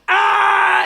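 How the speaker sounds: very high, loud scream; long imitated child's battle cry; kid's charging scream. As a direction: scream like a boy bursting from hiding. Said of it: "that's his cue to go".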